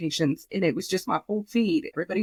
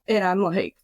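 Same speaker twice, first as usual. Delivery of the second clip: ordinary talk, nothing marked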